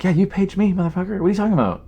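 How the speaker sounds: high-pitched indignant comeback; worked-up, incredulous pitch; retold angry retort. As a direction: act out snapping back at an agent who ignored you. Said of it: "now I'm just angry".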